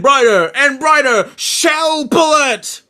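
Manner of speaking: high, drawn-out attack call; mock battle cry. Retelling a favourite scene, mock battle cry. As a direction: stretch it out high like an anime hero.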